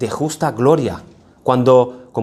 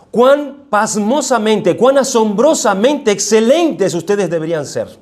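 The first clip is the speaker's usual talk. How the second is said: "pasmosamente" drawn out slowly, higher and marvelling; amazed exclamation; 5 semitones higher than usual. Shown as a demonstration. Pastor showing the congregation how the verse sounds, slow and amazed. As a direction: stretch "Cuán pasmosamente" in wonder. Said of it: "Se podría traducir de esta manera".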